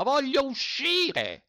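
very high, slow, drawn-out shout. A narrator's reading. Trapped mender howls at the landowner, very high and slow. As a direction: howl to be let out. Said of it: "gridò Zi Dima".

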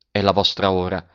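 ordinary delivery, nothing marked

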